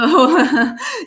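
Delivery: high laugh; burst of laughter. Real, not acted. Reader laughing at the fairy card, high laugh. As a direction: laugh out loud.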